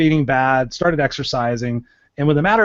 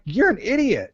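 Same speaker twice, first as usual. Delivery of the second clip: scornful verdict; 8 semitones higher than usual. Retold or imitated. Mocking reaction to side effects.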